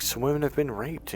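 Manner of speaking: softly, guarded about a taboo word; discreet, hushed aside. Real, not acted. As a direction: drop the volume as if not to be overheard. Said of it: "don't wanna say that word too loud".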